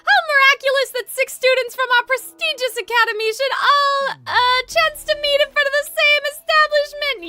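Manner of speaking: high, grand theatrical delight; Rook. Acted. Rook greeting students at the same establishment, flamboyant. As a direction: marvel aloud in a lofty high voice. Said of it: "Your Rook voice acting is so good".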